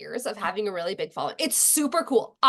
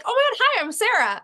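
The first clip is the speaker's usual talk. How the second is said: high excited hi; 10 semitones higher, 4 dB louder than usual. Retold or imitated. Her own greeting mimicked.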